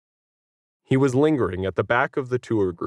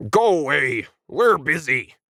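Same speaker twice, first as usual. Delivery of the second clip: muffled shout; 6 semitones higher than usual. A narrator's reading. Alien yelling from behind the door, shouting. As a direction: shout through a closed door. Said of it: "unfamiliar muffled voice finally seeped out".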